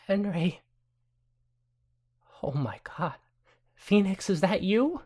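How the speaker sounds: high, amazed recognition; astonished. Acted. Old mother recognizing Phoenix, amazed and high. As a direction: gasp out the name in surprise. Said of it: "Adelaide says".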